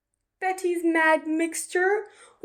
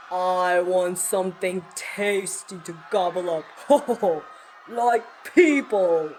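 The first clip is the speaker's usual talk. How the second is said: greedy dragon; 7 semitones lower than usual. Acted. Dragon threatening the town, lower and gloating.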